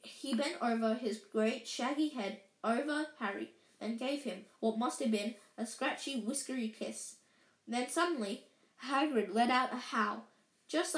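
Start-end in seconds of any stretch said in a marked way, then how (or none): none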